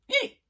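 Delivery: high squeaked hiccup; comic hiccup yelp; loud hiccup. A narrator's reading. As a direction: squeak out a sudden cartoon hiccup. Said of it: "They were very loud hiccups".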